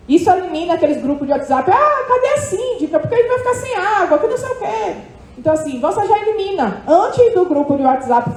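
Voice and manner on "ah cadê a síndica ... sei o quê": high-pitched mock whine of complaint; complaining residents. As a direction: mimic agitated residents griping, pitched way up. Mimics residents complaining in WhatsApp group.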